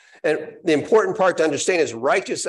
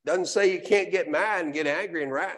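usual speaking voice

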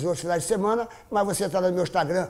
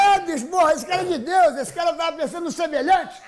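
high excited praise; raving, pitched up; 8 semitones higher than usual. Real, not acted.

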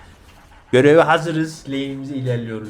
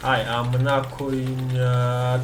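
usual speaking voice

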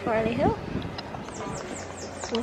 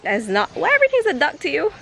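usual speaking voice

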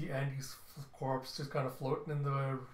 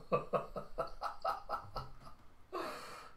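high, breathless laugh of delight; real delighted laugh; 5 dB quieter than usual. Real, not acted. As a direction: crack up at the character. Reader delighted by character.

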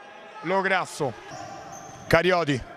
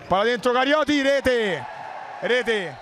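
rising excited goal call; breathless italian goal commentary; 4 semitones higher than usual. Real, not acted. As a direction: burst out as the ball goes in. Goal scored, commentator erupts. (telecronaca del gol concitata)

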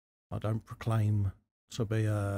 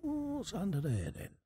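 high-pitched curious "ooh", play-acted; mock curiosity; 11 semitones higher than usual. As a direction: act out a viewer's intrigued wondering, pitched well up. Acts out the curious viewer's thought.

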